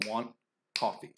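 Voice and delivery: "want", a pause, then "coffee"; beats on content words. Shown as a demonstration. Teacher marking where the beats fall, spaced stresses. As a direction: land "want" and "coffee" as two separated beats. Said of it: "In between the beats".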